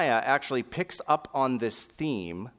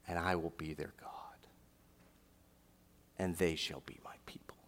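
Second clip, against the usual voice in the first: hushed whisper; 6 semitones lower, 12 dB quieter than usual. Acted. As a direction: let the promise fall to a whisper. Climax after shouted verse.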